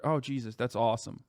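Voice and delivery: ordinary talk, nothing marked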